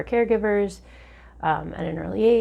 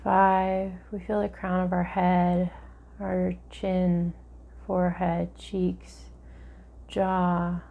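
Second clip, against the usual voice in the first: guided meditation; 4 dB quieter than usual. Acted.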